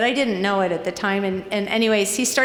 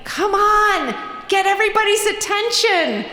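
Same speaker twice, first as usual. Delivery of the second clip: high, loud, pushy call; urging shout; 10 semitones higher, 4 dB louder than usual. Retold or imitated. Johnny urging the narrator to get everyone's attention, high and loud. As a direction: push her to rally the room. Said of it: "he's like".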